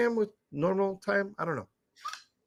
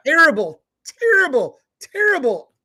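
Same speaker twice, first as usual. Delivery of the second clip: emphatic terrible; 9 semitones higher, 6 dB louder than usual. Real, not acted. Ranting about a bad accent.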